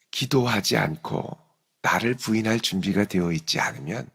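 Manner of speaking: ordinary talk, nothing marked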